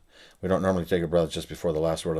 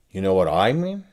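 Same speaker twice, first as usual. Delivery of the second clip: "mean" louder with a rising end; stress on mean; 5 dB louder than usual. Shown as a demonstration. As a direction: punch "mean" in "You know what I mean?". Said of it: "I change the meaning subtly by changing the emphasis".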